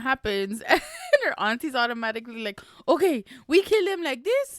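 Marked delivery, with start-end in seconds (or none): 2.9-4.6 high-pitched, eager plotting voice; excited aunties. Retold or imitated. Aunties jumping straight to a murder plan, excitedly. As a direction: act out over-eager aunties, pitched well up.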